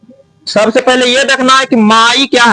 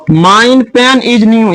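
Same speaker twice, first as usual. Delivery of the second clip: each word drawn out very slowly for the class; slow dictation; much slower than usual. Shown as a demonstration. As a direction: read the sentence slowly, word by word. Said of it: "ये गलत लग रहा". (बहुत धीरे, शब्द-शब्द अलग करके)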